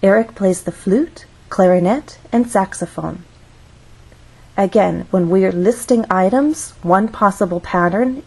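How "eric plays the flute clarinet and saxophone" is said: up on "flute", up on "clarinet", down on "saxophone"; rising list intonation. Shown as a demonstration. Teacher reading the example for learners, rising then falling list intonation. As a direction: lift each list item, "flute" and "clarinet", and close with a fall on "saxophone". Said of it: "Listen to how I read that sentence".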